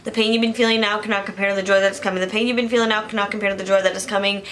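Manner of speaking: verse rattled off very fast, twice over; rapid repeated recitation. Shown as a demonstration. Repeating a verse to herself when getting anxious, rapid recitation. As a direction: recite it quickly on a loop, like a mantra. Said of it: "keep saying the verse over and over again".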